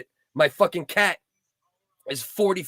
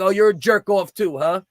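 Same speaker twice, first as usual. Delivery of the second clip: sarcastic jab, higher and louder; mocking insult; 4 semitones higher, 5 dB louder than usual. Retelling how he insulted the dispatcher, mock angry jab. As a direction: sneer at the operator. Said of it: "Started cursing him out".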